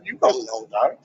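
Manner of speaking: lightly voiced smug official dismissing the question; mock deep voice. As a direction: speak as a smug authority figure. Mock authority reply.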